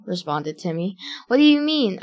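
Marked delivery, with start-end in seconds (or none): 1.3-2.0 higher-pitched exclaimed question; exclaiming. A narrator's reading. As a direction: read it as a lively exclamation. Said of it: "I exclaimed".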